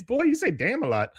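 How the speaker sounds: high-pitched character voice; retold remark. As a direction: say it in a put-on high voice. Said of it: "He says".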